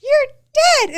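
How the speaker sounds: high, loud mock scream. Wife imitates her husband screaming his threat. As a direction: shriek it as an over-the-top impression. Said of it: "so he screams".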